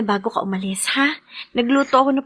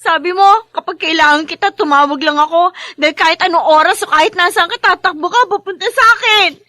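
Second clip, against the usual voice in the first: higher, louder, hurt and accusing; tearful reproach; 6 semitones higher, 4 dB louder than usual. Acted. Wife throws her husband's broken promise back at him, tearful and loud.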